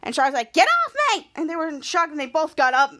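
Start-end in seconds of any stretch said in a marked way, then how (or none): 0.5-1.3 high, loud yell; shouted protest. Retold or imitated. Charlotte to Ray sitting on her, high loud yell. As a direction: shove him off with your voice.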